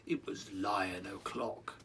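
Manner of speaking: slow hushed whisper; dramatic whisper. A narrator's reading. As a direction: whisper it like a secret. Dramatic lion introduction.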